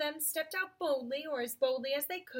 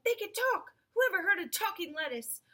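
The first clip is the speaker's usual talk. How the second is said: high, indignant little argument; triumphant; 6 semitones higher, 4 dB louder than usual. A narrator's reading. Mole arguing against the rabbit's lettuce idea, triumphant. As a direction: argue it as plainly proven.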